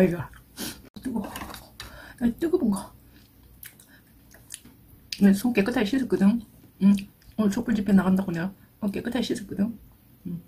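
chewing while talking; mouth full. Real, not acted. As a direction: food on tongue. Seen 0: fingers push red food into mouth, chews while talking; heat unseen.

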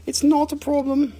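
high-pitched mock consoling; playful cooing. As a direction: coo sweetly as if comforting a pet. Consoles the crystal as if alive.

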